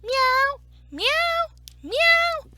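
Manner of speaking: high, loud repeated meows; three big high cat cries; cat's meows. Acted. Storyteller making animal noises with children, high loud meows.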